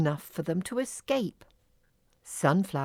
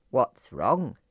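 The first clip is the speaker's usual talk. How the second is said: low, loudish frog voice; croaky frog; 6 semitones lower than usual. A narrator's reading. Frog asks the fairies what's wrong, croaking. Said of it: "croaked Blossom".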